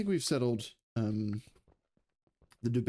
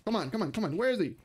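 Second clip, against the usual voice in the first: high, tense and urging; urgent excited; 8 semitones higher than usual. Real, not acted. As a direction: urge it on, rising to a yell. Tense fight, urgent calling.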